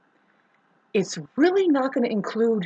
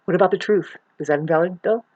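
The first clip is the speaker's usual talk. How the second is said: ordinary delivery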